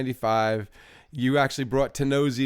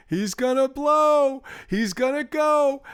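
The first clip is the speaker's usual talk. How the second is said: much higher, louder, slow alarmed wail; mock alarmed cry; 13 semitones higher, 4 dB louder, slower than usual. Imitating his friend about to pass out, high alarmed wail. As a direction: belt it out like someone about to keel over.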